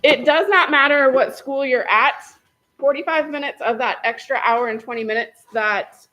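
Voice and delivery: loud, firm classroom voice; teacher voice. Real, not acted. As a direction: project like a teacher to the back row. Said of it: "I'm just gonna use my teacher voice".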